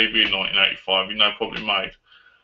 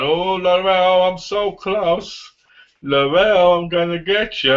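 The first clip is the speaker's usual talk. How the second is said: higher, slow, drawn-out stalker call; hammed-up creepy chaser; mock villain taunt; 5 semitones higher, slower than usual. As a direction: imitate a bad guy calling teasingly on the hunt.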